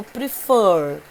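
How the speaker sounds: "prefer" slowly, with the second syllable stretched and loud; teacher's stressed "prefer", weight on the end; stress on last syllable. Shown as a demonstration. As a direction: lean hard on the final syllable of "prefer". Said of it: "Fur é a sílaba tônica".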